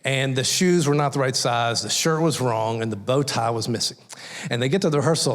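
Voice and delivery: ordinary talk, nothing marked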